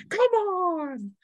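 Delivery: high, eager battle taunt; reckless warrior's challenge. Acted. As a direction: dare the birds to come at him. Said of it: "He's insane".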